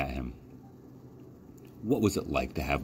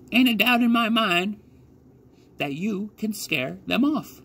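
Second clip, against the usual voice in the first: put-on old country woman; 14 semitones higher than usual. A narrator's reading. Old lady tells the scarecrow rabbit he'll scare birds, high old voice. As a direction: sound like a rough old farm woman. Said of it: "the old lady said".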